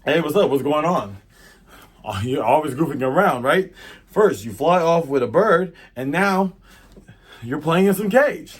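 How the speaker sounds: lightly acted loud teasing; Zoro. Swordsman chides his caged captain, lightly acted scolding. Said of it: "Zoro's like".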